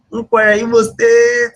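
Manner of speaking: high, loud and drawn out in delight; crying out the answer, thrilled; excited shout. Real, not acted. Host announcing the winning answer to viewers, thrilled shout.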